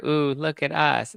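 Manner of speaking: lightly hammed-up reality star; mock posing celebrity. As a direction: coo it like a star posing for cameras. Mocking staged paparazzi shot.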